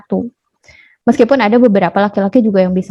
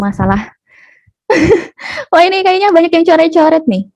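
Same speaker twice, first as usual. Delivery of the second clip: usual speaking voice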